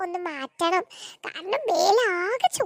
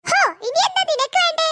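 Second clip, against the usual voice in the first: irritated snap; 7 semitones higher, 3 dB louder than usual. Acted. Irritated mother snaps.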